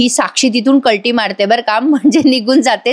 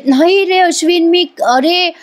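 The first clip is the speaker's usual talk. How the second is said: frightened; 7 semitones higher than usual. Retold or imitated. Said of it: "एवढी घाबरलेली दाखवली".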